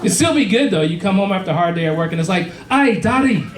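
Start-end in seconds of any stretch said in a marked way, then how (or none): 2.7-3.4 a little girl doing a bad Conor McGregor; bad Irish accent. Comic dad voicing his toddler in a bad Irish accent. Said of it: "she just sounded like Conor McGregor".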